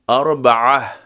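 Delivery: normal talking voice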